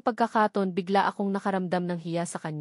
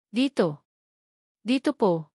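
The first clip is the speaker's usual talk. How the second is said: calling out; 3 semitones higher than usual. A narrator's reading. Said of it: "narinig ko pang sigaw ni Marisa".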